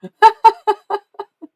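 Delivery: high, loud staccato laugh; hard laughing. Real, not acted. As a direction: burst out laughing at your own remark. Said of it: "Why am I laughing so hard".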